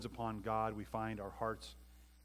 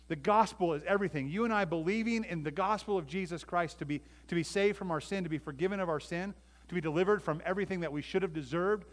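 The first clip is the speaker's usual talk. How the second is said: raised, higher preaching voice; impassioned preaching; 6 semitones higher, 5 dB louder than usual. Real, not acted. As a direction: preach it with full conviction.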